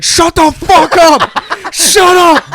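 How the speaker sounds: high, very loud, drawn-out shouting; mock furious scream. Voter answering his critics, screaming furiously. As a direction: scream them down in exaggerated rage.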